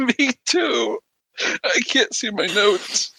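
high giggly voice; breathless giggles through words; hiccup giggles. Real, not acted. Still laughing hard.

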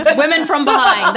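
laughing. Real, not acted. Co-host pitching a naughty name to friends, laughing.

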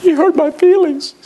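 loud, high mock complaint; wounded churchgoer. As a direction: put on a hurt, whiny member's voice. Mimics a wounded church member.

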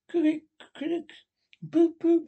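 high-pitched creaks and boops; strange suppressed noises. A narrator's reading. Toad making strange noises to himself, high and comic. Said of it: "making strange pers-, pers-, noises".

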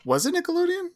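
surprised. Real, not acted. Surprised question.

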